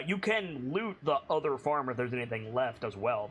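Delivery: usual speaking voice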